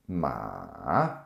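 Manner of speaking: drawn-out "Ma" dipping low, then rising; third tone, dipping. Shown as a demonstration. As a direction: overpronounce "Ma" down through a valley and up. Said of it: "then it goes up again".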